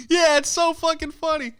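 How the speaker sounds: high, loud sarcastic shriek. Retold or imitated. Mocking the rich old man, high and loud sarcasm. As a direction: sneer it back in a mock squeal.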